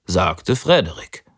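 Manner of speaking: ordinary delivery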